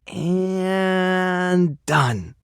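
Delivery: long, held lead-up to done; drawn-out finishing. Acted. Groomer finishing off a snag on a tail, drawn out. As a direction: stretch "and", then land "done".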